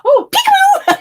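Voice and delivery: high, loud teasing peekaboo rising to a squeal; playful spirit. Retold or imitated. Spirit mother joking about scaring her daughter, high and teasing. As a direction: tease like a ghost playing peekaboo. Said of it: "She goes".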